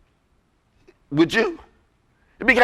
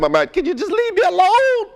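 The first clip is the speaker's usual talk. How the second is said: pitched way up, fed-up and pleading; exasperated plea; 12 semitones higher than usual. Retold or imitated. Tired flyer pleading with a chatty seatmate, high and exasperated. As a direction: beg to be left alone, exasperated. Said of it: "And I'm saying".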